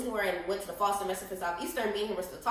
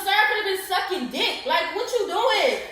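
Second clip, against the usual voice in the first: louder and higher, scolding him; indignant callout; 7 semitones higher, 4 dB louder than usual. Retold or imitated. Telling Baby Daddy off for sipping her cold drink, loud and indignant. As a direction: call him out for stealing the drink. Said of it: "I told him".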